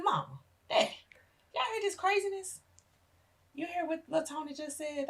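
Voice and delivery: high, disbelieving call across the room; incredulous call to parents. Retold or imitated. Daughter calls out to her parents in high disbelief. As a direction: laugh it off as crazy talk to Mama and Dad.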